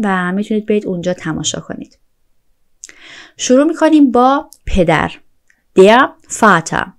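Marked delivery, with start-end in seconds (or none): 5.8-6.9 slow, careful German; slow model word. Shown as a demonstration. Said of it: "slow model word".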